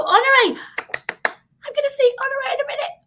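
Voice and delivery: high, quick squeal of delight at a friend; greeting her mentor with bright excitement; excited greeting. Real, not acted.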